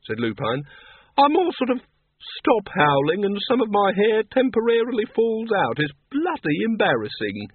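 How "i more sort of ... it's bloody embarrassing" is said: higher-pitched, sheepish character voice; embarrassed wolf-man. Acted.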